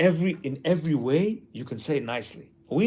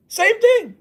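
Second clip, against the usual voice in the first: mock kids' outburst; 5 dB louder than usual. Kids copy father.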